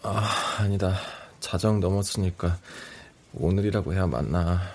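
low, drowsy murmur; half-asleep correcting himself; sleep deprived. Acted. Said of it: "졸음이 쏟아지는 상태".

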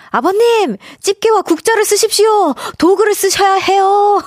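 high, loud emphatic plea; emphatic urging. Acted. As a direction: urge the father eagerly. Reads listener's urging quote.

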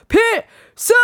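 shouted military salute, high and loud; loud gung-ho soldier. Acted. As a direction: bark the salute like a soldier on parade. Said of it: "군인 말투로 해가지고".

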